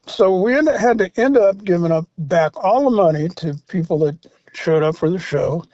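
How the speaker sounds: ordinary talk, nothing marked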